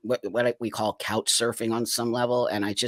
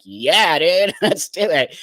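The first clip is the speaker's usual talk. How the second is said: louder, higher, laughing mock enthusiasm; eager agreement; 5 semitones higher, 7 dB louder, slower than usual. Agreeing to party with a stranger, eagerly. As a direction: play along keenly, with a laugh.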